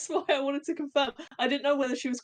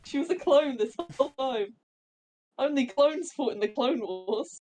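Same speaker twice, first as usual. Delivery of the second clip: mock shock; 4 semitones higher than usual. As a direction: act out stunned Imperials, squeaking. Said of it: "if they'd just been like".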